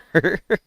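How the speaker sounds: high, loud burst of laughter; real amused laugh. Real, not acted. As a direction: laugh out at the result. Laugh after coin flip.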